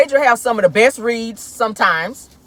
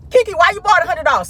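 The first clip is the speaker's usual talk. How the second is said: mock commenter; 8 semitones higher, 3 dB louder, faster than usual. Commenters questioning Keke.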